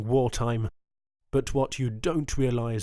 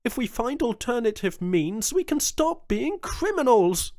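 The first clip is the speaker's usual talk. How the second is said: high, loud, driving plea; rally him with passion; impassioned governor; 12 semitones higher, 5 dB louder than usual. A narrator's reading. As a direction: Jones arguing to stop the piracy, high and loud. Jones's long impassioned plea.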